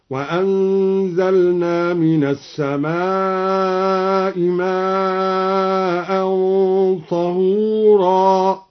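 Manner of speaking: very slow chant, وهو and نشرا with nun; وهو with silent ha, نشرا. Shown as a demonstration. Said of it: "جمع ناشر هكذا". (تسكين الهاء ونشرا بالنون)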